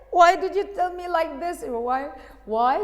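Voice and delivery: loud, high wail of a hurt wife; mock tearful protest. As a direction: cry out the complaint, hammed-up. Insulted wife wails at her husband.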